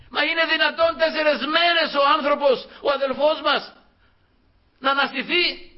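high, astonished questioning; preacher voicing an incredulous sister; incredulous protest. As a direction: object in acted disbelief, pitched well up. Sisters doubting a resurrection, acted.

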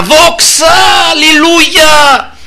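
high, drawn-out hallelujah shout; shouting with all might. Retold or imitated. Preacher re-enacts his mountaintop cry to God, full shout. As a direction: belt it out, vowels held long. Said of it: "με όλη μου τη δύναμη".

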